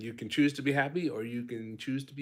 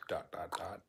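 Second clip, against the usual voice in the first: low whispered tease; 6 semitones lower, 8 dB quieter than usual. Real, not acted. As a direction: whisper the dots softly. Whispering the trailing dots.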